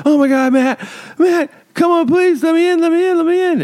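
high, frantic begging to be let in; panicked pleading. Acted. Terrified woman begging at her neighbor's door, high frantic pleading. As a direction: plead in a fast-tumbling, high-pitched mock panic.

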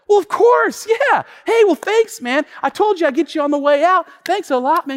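very high, loud backpedalling; hammed-up sudden friendliness; over-friendly thanks. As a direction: cover embarrassment with eager thanks. Sheepish over-friendly thanks to valet.

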